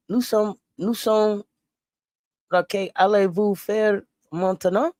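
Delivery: slow, careful mock French; hesitant French attempt. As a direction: sound out the French slowly. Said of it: "so I can sound French".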